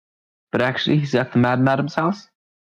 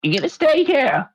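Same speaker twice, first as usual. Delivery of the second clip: squeaky high guard voice, pitched way up; guard's challenge. Acted. GM voices a guard questioning the player, high. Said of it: "he goes".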